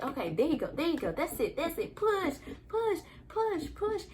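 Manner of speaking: high, sing-song encouraging coaches; coaching nurses. Retold or imitated. Nurses urging mother to push, high encouraging chant. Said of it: "They're like".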